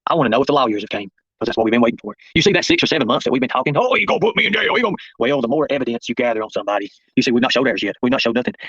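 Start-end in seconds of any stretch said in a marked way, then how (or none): 3.8-4.9 high-pitched mock threat; mocking imitation. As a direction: mimic their threat in a high, jeering voice. Mimics their jail threats.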